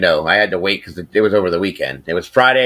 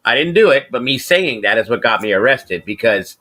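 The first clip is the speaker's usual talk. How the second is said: ordinary delivery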